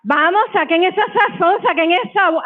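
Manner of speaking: high, energetic pep call in Spanglish; rallying the crowd. Real, not acted. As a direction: fire the audience up.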